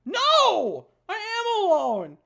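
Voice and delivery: high, loud, slow denial; anguished cry. Acted. Voiced Michael shouting at Monica in despair.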